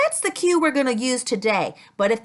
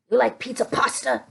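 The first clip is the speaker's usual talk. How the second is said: fast playful chef asking, a little softer; quick chef puppet voice; 5 semitones lower, 4 dB quieter, faster than usual. Acted. Chef puppet asking kids about pizza, lightly voiced. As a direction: rattle it off like a chef.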